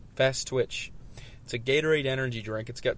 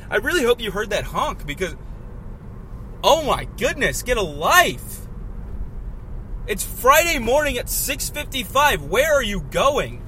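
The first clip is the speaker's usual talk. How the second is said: higher and louder, still fuming; angry rant; 7 semitones higher, 3 dB louder than usual. Real, not acted. Driver still ranting at the car that honked, angry. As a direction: keep venting at the honker.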